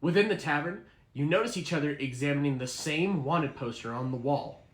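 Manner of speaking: ordinary delivery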